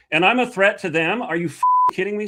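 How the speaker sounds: rising outrage, pushed up and loud; angry outburst. Real, not acted. Guest venting fury at the FBI to the host. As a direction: let the anger spill over. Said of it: "I do that when I get mad".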